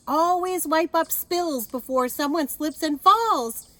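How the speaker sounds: slow booming safety warning; Officer Buckle lecturing. A narrator's reading. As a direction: proclaim the rule to the auditorium. Buckle announces safety tip two.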